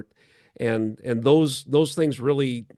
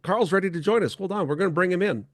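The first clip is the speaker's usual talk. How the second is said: higher, eager host voice; real excitement, pitched up; excited; 6 semitones higher than usual. Real, not acted. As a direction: lit up as the pastor joins live. Guest unexpectedly joins live show.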